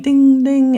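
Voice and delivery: high, loud doorbell imitation; ringing doorbell. Storyteller imitating the doorbell in the doorway, ringing. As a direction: sing out a doorbell chime. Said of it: "with the doorbell ringing".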